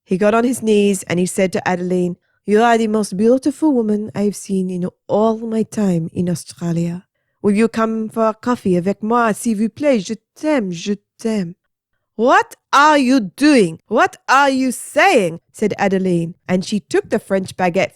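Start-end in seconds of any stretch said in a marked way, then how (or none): 2.5-11.5 put-on lightly French-accented suitor, pleading. French teacher begging Adeline in a French accent. 12.1-15.4 high, loud, slow outraged woman; shocked Adeline. Acted. Adeline to kneeling teacher, high outraged.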